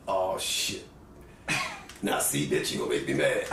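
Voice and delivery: put-on very low, grumbling old star. Star annoyed by a heckler's request, low grumble. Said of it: "And he".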